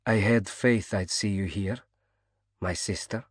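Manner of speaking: lightly voiced East European accent; soft East European accent. A narrator's reading. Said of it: "in a soft East European accent".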